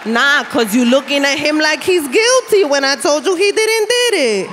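sassy lawyer scolding the judge; Bronx lawyer arguing. Retold or imitated. Comic imagining the lawyer talking back to the judge. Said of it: "your lawyer's like".

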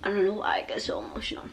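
fast, unvoiced whisper fighting tears; choked-up whisper about getting emotional; holding back tears. Real, not acted. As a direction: whisper it quickly, trying not to cry. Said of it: "I'm just trying not to cry".

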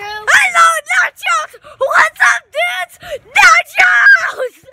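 high shrieked silly greetings; loud yelling. Real, not acted. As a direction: yell it out wildly.

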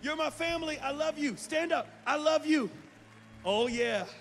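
loud, high, rousing preacher calls; preaching at full force. Acted. Pastor rallying the congregation to stand, loud and rousing. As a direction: fire the whole room up to stand.

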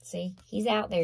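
normal talking voice

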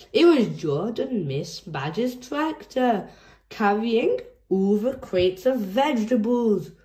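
usual speaking voice

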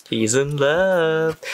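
slow playful singsong; teasing singsong. Real, not acted. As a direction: stretch it out slowly to tease a crush. Teasing a character about a crush.

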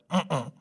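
short closed-mouth "mm-mm"; closed-mouth refusal. Acted. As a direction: refuse with lips shut, cheeks full. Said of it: "wouldn't even open his mouth".

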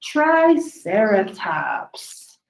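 ordinary delivery, nothing marked

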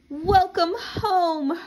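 high, slow sing-song mother greeting. Acted. Mother welcoming her daughter home, high and sing-song. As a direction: croon it high and slow like a happy mom.

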